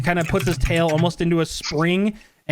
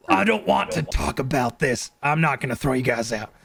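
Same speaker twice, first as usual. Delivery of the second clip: lightly voiced weary bouncer; a hint of a tough bouncer; 3 semitones lower than usual. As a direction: brush them off as the doorman. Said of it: "And he's like".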